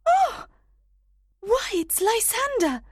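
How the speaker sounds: very high, loud gasp of discovery; surprised cry. A narrator's reading. Helena finds Lysander on the ground, high surprised cry. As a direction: cry out in astonishment. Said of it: "She cried out in surprise".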